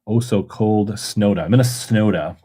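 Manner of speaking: ordinary talk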